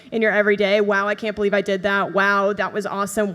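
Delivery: usual speaking voice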